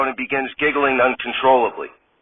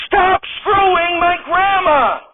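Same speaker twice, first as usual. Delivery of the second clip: high, loud crying shout; wailing shout; 4 dB louder, slower than usual. Acted. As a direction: wail it in outrage. Wife shrieking at [name] again.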